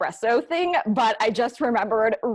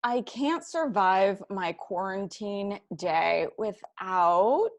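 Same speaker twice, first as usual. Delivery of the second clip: slow game prompt; 3 semitones lower, 5 dB quieter, much slower than usual. Pretend. Slow sentence-start prompt.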